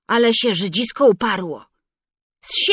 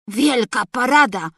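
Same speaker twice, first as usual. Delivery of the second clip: ordinary delivery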